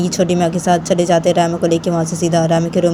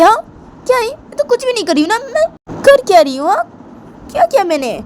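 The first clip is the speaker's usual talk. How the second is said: put-on squeaky high girlish protest; childish protest; 12 semitones higher than usual. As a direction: protest your innocence like a sulky girl. Bani protests to family.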